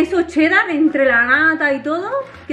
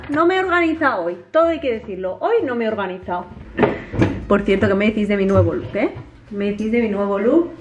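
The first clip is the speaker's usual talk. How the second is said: ordinary talk, nothing marked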